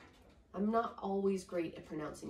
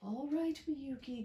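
sighing; 7 semitones higher than usual. Acted. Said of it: "Grandfather sighed".

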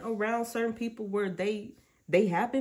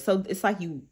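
ordinary delivery

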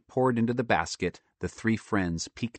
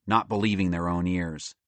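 ordinary delivery, nothing marked

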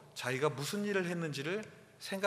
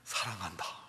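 soft, low loving words; father to his son after a meal, tender; tender love; 8 semitones lower, 5 dB quieter than usual. Retold or imitated. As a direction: say it gently to your son.

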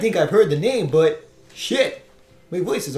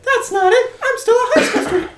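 put-on much higher, laughing girl voice; high character voice; 5 dB louder than usual. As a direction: pitch up, giggly. Voicing a game character.

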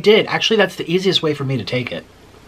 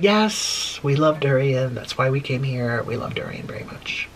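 soft, sinking voice, fading to a whisper; acted meek answer that dies away; embarrassed polite reply; 3 semitones lower, 5 dB quieter than usual. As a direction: trail down into a whisper. Recalled embarrassed reply in elevator.

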